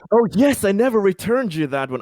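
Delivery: sudden high, loud realisation; guilty surprised laugh in the voice; caught out. Real, not acted. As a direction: blurt it out as it dawns on you. Caught out over stolen device.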